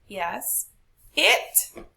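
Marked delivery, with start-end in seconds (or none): 1.1-1.8 IT pitched high and loud; stress on IT. Shown as a demonstration. Teacher testing if the word takes stress, stressed. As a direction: overstress "It" with a jump up in pitch. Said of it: "Do we have to say it".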